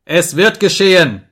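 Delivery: high, loud insistence; explosive angry retort; furious shout. A narrator's reading. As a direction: overrule the refusal with a yell. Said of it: "schrie ich".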